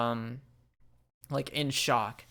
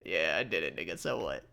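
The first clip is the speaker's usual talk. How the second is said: low taunting voice; 8 semitones lower, 6 dB quieter than usual. Acted. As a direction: taunt him in a low lazy voice. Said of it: "You're like".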